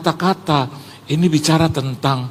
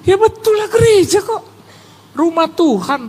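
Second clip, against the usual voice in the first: very high, insistent voice; mock emphatic reply; 12 semitones higher than usual. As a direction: exaggerate the self-evident answer. Jawaban dibesarkan dalam cerita. (menegaskan dengan suara sangat tinggi)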